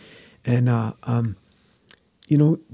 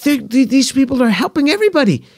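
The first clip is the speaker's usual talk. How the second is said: much higher and louder, an indignant mother ranting; mother's voice; 11 semitones higher, 8 dB louder than usual. Retold or imitated. Mother giving her verdict on the New Testament, high indignant rant. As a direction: imitate his mother fuming at Jesus. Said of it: "she said".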